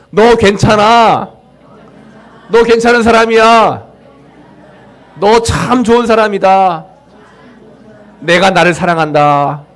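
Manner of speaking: preacher calling out encouragement to himself; declared affirmation. Shown as a demonstration. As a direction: lead the congregation in a bold self-blessing. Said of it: "한번 해볼까요?".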